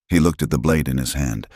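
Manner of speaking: plain reading voice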